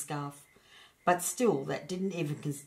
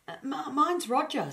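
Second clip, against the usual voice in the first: high, hesitant little stammer; shy small creature giving his name; timid hedgehog voice; 10 semitones higher, 3 dB louder than usual. A narrator's reading. Hedgehog introducing himself to the dragon, high and shy.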